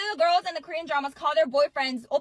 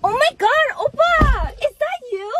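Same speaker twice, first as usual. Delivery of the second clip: loud, high squeal of delight; higher and louder Oppa greeting, overjoyed; excited fangirl; 9 semitones higher, 8 dB louder, much slower than usual. Acted. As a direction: gush at him like a thrilled fan. Girl recognizing her Oppa excitedly.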